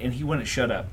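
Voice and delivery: usual speaking voice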